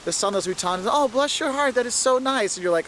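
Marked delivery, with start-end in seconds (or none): 0.9-2.6 high-pitched, sugary fake compliment; put-on niceness, pitched well up; mock Southern sweetness. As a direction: imitate a Southerner being sweetly insulting. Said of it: "actually insulting you".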